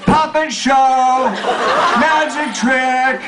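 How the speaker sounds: high, drawn-out scream. A narrator's reading. Brittany flaunting it before the bus riders, high drawn-out scream. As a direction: scream each word long and high. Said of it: "Brittany screams".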